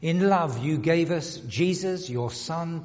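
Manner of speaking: ordinary delivery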